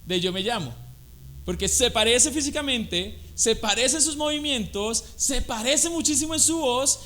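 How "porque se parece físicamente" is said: ordinary delivery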